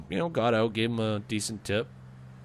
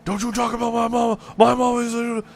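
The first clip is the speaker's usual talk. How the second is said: much higher and louder, an angry put-on yell; yelling back; 13 semitones higher, 8 dB louder than usual. Caller yells back at the prank guy in a high, loud put-on voice. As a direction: bellow it back in a silly voice. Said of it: "yelling at the guy back".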